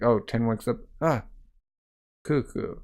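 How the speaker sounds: ordinary delivery, nothing marked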